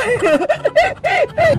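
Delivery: high-pitched mischievous laugh; gleeful prankster cackle. Acted. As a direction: cackle in triumph at your prank. Prankster laughs at finished makeup. (matinis na pilyong halakhak)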